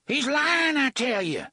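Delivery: high, loud desperate cry. A narrator's reading. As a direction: blurt it out in panic. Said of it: "Gasher cried".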